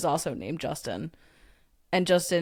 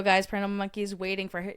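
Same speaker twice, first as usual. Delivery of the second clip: ordinary delivery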